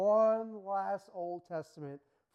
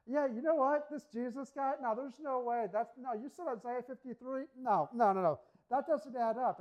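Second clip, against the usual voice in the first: loud, higher, scornful heckling; mock hostile crowd; 6 semitones higher, 5 dB louder than usual. Crowd heckles Paul after last week's praise, loud mock scorn. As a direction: jeer at him like a turned crowd. Crowd contradicts Paul's teaching.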